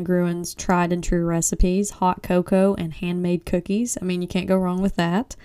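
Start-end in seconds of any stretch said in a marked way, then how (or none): none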